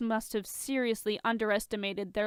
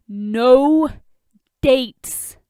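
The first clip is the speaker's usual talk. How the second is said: loud mocking echo of no dates; scoffing; 4 semitones higher, 13 dB louder than usual. A narrator's reading. Tony mocking Bruce's rule, loud scoff. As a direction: scoff it back at him, loud. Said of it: "Tony scoffs".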